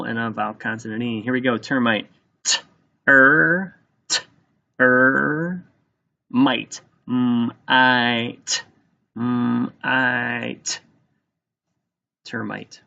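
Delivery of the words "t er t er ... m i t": "T, er" twice, then "might" broken into "M, I, t"; sounded out. Shown as a demonstration. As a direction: tap out "T, er" and "M, I, t" sound by sound. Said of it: "Here we go, two syllables".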